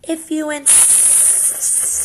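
breathy, very slow, hissed reply; hissing snake. Acted. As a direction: hiss it out like a boa. Snake accepts.

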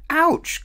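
high, loud yelp of pain; pained ouch. Acted. Little Miss Naughty yelping when her nose is tweaked. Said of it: "cried Little Miss Naughty".

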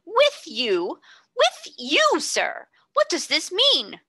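aghast and offended at once; amazed indignation. A narrator's reading. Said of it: "fell back in amazed indignation".

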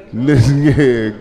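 ordinary talk

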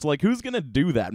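ordinary talk

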